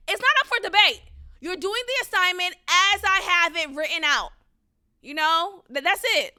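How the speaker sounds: put-on teacher scolding a child, loud and firm; stern teacher. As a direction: slam the door on the argument.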